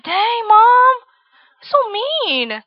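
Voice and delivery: put-on high, drawn-out childlike protest; mock shocked kid. Young daughter reacting to mom snubbing her friend, mock appalled.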